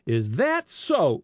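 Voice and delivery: slow, nettled retort. A narrator's reading. Police chief stung by the boy's jibe, nettled. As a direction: draw it out, stung and challenging.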